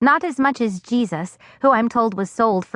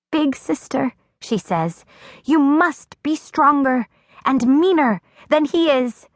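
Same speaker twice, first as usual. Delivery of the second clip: put-on higher, small child's voice, earnest; a tiny girl urging her sister on; little sister; 6 semitones higher than usual. A narrator's reading.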